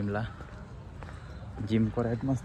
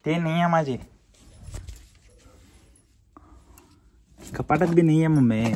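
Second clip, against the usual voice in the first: calling out; 5 semitones higher, 6 dB louder than usual. Real, not acted. Arguing with mom about pants.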